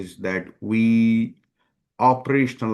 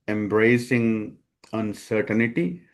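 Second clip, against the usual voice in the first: ordinary talk, nothing marked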